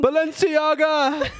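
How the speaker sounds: high, slow, squealed brand name; gushing runway shout. Pretend. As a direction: shriek it like a fashion fan.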